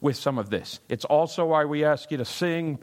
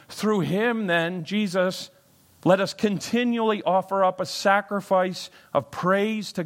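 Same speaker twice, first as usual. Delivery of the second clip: raised, louder scripture read; 6 semitones higher, 4 dB louder than usual. Acted. Pastor proclaiming Hebrews thirteen to the congregation, raised. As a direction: proclaim the verse to the room.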